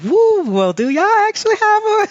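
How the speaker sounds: high, loud teasing wonder at fast talkers; mock surprise. To Philly friends, mock astonished and high. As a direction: squeal it like you can't believe how fast they talk. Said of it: "friends from Philly, I'm like".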